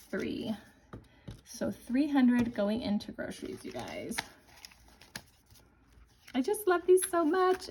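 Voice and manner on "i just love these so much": gushing. Real, not acted. Gushing about new binders.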